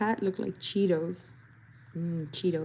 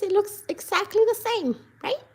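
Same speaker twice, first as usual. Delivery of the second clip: mock-proud; 12 semitones higher, 4 dB louder than usual.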